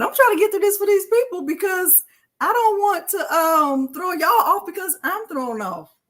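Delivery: near tears. Real, not acted. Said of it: "'cause I am going to cry".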